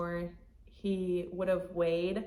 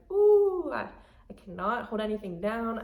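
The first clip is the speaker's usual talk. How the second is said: retold nausea; 6 dB louder than usual. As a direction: lightly act out feeling sick to your stomach. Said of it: "I got really nauseous".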